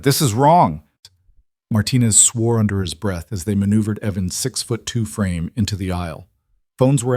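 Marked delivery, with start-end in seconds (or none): 0.0-0.8 loud outraged call; shouted. A narrator's reading. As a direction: shout it from the back. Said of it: "Someone shouted".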